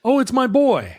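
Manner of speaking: high mock joy as the mother; mock mother's cry. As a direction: pitch it up, a touch of mock joy. Mother voiced confirming.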